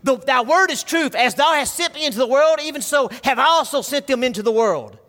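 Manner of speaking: loud, high, rising preacher's call to the congregation; full-force preaching. Real, not acted. Pastor urging the church to stay apart from evil, preaching at full force. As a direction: pour it out from the pulpit, building to a climax.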